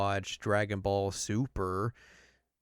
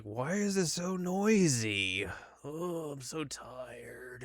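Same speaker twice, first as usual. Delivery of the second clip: slow, sleepy god just woken, higher; groggy and whiny, drawn out; drowsy Beerus; 5 semitones higher, 5 dB quieter, much slower than usual. Retold or imitated. Beerus woken by noise, groggy and slow. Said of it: "Beerus wakes up and is like".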